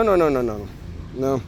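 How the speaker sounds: ordinary delivery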